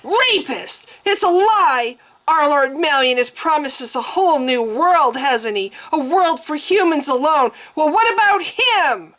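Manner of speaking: high, loud raging accusation; furious tirade. A narrator's reading. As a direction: hurl it at him in rage.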